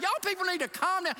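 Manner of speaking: high, fast, rattled plea; nervous retort. Retold or imitated. Pastor telling the stern crew to calm down, rattled. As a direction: beg them to ease up, half laughing at himself.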